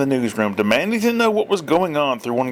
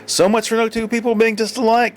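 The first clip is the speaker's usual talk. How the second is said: ordinary delivery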